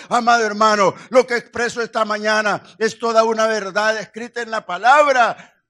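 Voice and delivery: loud, fervent sermon delivery; preaching at full force. Acted. Preacher to the congregation, full-force fervent preaching. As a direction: rouse the congregation to wake up.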